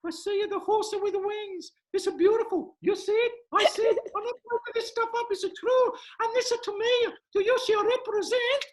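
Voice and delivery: hammed-up psychic reading a child's tea leaves; Madame Markovsky. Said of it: "Madame Markovsky".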